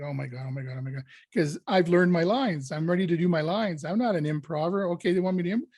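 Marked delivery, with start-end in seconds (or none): 0.0-1.0 softer, quick retold panic; panicked muttering. Actor told to improvise, softly panicking to himself. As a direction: mutter to yourself in rising panic. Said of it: "all of a sudden I start panicking".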